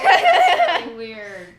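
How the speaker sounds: loud, laughing that's really weird. Real, not acted. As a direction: laugh it out, grossed out. Laughing disgust at relative.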